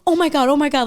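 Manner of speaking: high, loud panicked "Oh my God". Retold or imitated. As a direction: panic as the guy shows up. Panicking as stalker appears.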